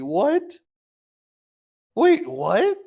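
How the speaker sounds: high, sharp disbelieving what; high incredulous double take; stunned double take. Real, not acted. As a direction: caught off guard all over again. Second look at the account.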